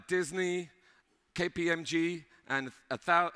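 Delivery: usual speaking voice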